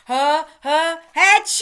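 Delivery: building huff into a loud achoo; big sneeze. Acted. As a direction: wind up and let the sneeze explode. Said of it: "You know what he's gonna do?".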